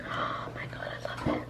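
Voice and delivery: breathless whisper of delight. Real, not acted. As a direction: whisper it like a secret treat. Tasting salad, hushed delight.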